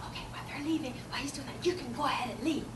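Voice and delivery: soft, half-whispered confidence; hushed aside. Acted. Teacher tells mom to leave unnoticed, hushed aside. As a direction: slip it quietly to a parent.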